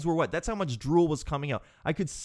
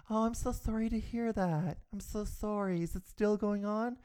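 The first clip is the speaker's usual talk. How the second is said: sweet receptionist; 6 semitones higher, 5 dB quieter than usual. Retold or imitated. Receptionist replying sympathetically.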